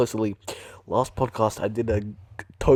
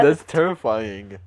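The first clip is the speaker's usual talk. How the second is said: usual speaking voice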